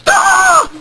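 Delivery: sharp high cry of frustration; high exasperated shriek; frustrated yelp. Retold or imitated. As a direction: let out a frustrated squeal. Phone hangs up, cry shout.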